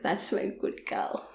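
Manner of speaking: hushed imitation of his praise; whispered quote. Lover whispering praise to her, whispered. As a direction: whisper it, soft and close.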